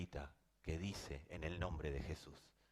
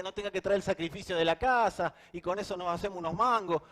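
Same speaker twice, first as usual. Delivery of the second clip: mocking voice of Israel; 12 semitones higher, 12 dB louder than usual. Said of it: "mocking Israel's expectation".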